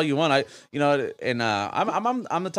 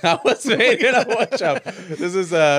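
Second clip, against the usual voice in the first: slow, higher puppet voice, laughing; 5 semitones higher, 4 dB louder, slower than usual. Pretend.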